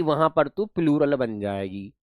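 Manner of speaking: ordinary delivery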